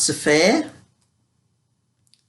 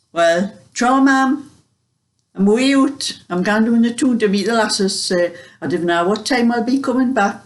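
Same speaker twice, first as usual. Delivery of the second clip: light, higher-pitched broad Geordie; Geordie slang; 4 semitones higher than usual. Retold or imitated. Daughter going out, telling her mother, in broad Geordie. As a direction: call out to mum in broad Geordie. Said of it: "I wasn't supposed to talk Geordie slang".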